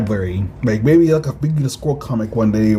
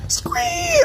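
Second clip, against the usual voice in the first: piercing high alien shriek; a creature's screech as it leaps. Acted. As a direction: let out a monster's shrill cry. Alien creature attacks in comic.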